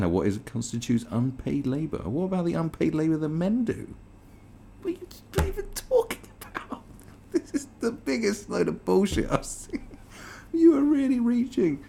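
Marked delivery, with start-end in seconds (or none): 4.8-11.8 high squeaky disbelief with laughter; incredulous laughing squeal. Real, not acted. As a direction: laugh at how absurd it is. Incredulous reaction to article.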